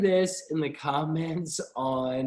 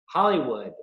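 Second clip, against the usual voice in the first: ordinary delivery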